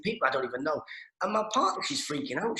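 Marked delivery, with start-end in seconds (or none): none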